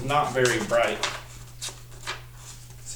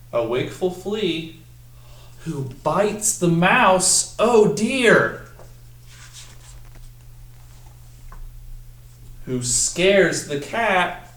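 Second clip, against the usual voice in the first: dramatic storytelling; 6 semitones higher, much slower than usual. Acted. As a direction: build the chaos for the kids word by word. Dramatic climax read to children.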